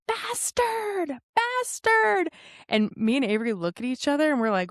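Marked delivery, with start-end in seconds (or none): 0.1-2.3 high mock scream of Bastard; imitating an angry driver screaming; retold screaming. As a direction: shriek it like a stranger yelling from a car. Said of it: "she just starts screaming".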